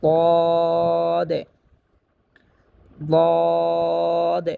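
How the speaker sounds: দদ said thick and full, held; heavy dad. Shown as a demonstration. Teacher showing students the heavy দদ, thick and full. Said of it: "মোটা করে উচ্চারণ করব".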